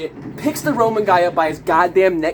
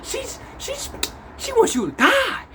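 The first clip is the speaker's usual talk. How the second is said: high-pitched comic accusation, dropping to near-whisper mid-way; mock accusing voice; 8 semitones higher, slower than usual. Others warn about Clarence, mock accusing. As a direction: squeal it like a scandalised gossip. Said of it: "everybody else think".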